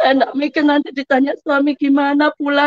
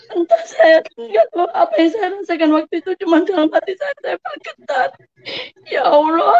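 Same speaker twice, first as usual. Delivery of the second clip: real tearful, higher-pitched voice; menangis haru; 5 semitones higher than usual. Real, not acted. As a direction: tell it through tears, voice rising high. Testimony, moved to tears retelling. (bercerita sambil menangis)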